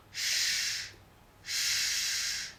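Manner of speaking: "sh" sordo, como mandando callar; sonido sh. Shown as a demonstration. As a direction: sopla "sh" con mucho aire. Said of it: "como si mandáramos callar". ("sh" como pidiendo silencio)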